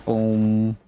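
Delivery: ordinary talk, nothing marked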